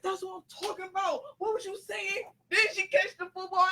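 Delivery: high, fast, hyped-up praise; excited. Retold or imitated. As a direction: cheer like a proud teammate. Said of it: "he was like".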